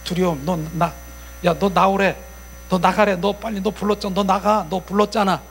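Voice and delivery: lightly acted urgent bickering; squabbling evil spirits. Spirits telling the one called to leave, urgent squabbling. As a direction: nudge one another to go out. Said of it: "이 얘네들이 그래".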